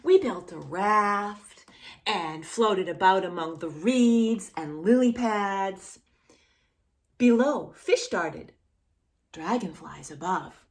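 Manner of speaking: slow, gentle storybook reading; reading to children. Acted. Reading the picture book aloud to a child, gentle.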